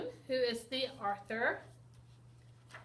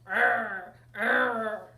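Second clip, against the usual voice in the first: Bigfoot growl; 10 dB louder than usual. Acted. Teacher shows how Ben sounded as Bigfoot, loud growl. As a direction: growl like a hairy monster. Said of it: "He sounded like this".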